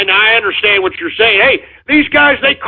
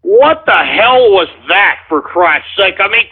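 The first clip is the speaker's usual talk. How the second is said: usual speaking voice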